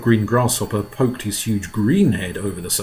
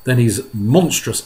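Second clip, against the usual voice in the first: usual speaking voice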